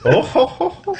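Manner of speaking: breathy huffing ho ho; Tim Allen grunt. Shown as a demonstration. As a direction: grunt it out like a handyman. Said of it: "I'm trying to think of how to do it".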